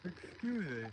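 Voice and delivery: talking through a yawn; words stretched by a yawn. Real, not acted. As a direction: excuse yourself mid-yawn. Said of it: "I'm yawning once again".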